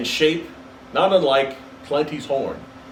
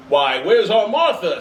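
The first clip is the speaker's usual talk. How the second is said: bright, higher, louder father; cheerful Bob; 5 semitones higher, 4 dB louder than usual. Acted. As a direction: call out for his daughter gladly.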